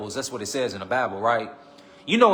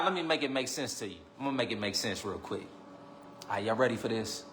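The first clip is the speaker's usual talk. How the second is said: much softer, lower and confiding; low murmur setting up the reveal; hushed build-up; 4 semitones lower, 11 dB quieter than usual. Real, not acted. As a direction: lean in and hush before the secret.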